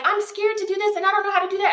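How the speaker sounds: high, fast, fretful imitation; mock-nervous rush of fears; anxious business owner. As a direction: rattle off the worries like a panicked newbie.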